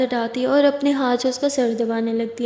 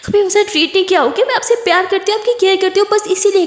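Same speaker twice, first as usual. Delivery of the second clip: high, louder, urgent defence; hurt protest; 7 semitones higher, 4 dB louder, faster than usual. Acted. Kiran defending her care to Aditi, upset. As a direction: insist on your love in a raised voice.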